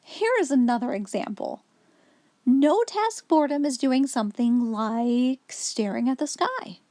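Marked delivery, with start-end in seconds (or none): none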